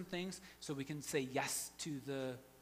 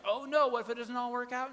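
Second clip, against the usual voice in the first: higher, louder fretting; mock anxious worry; 7 semitones higher, 7 dB louder than usual. Pastor voices his own anxious thoughts, hammed-up panic. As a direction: play up a panicky inner voice. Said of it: "And I'm, like, anxious".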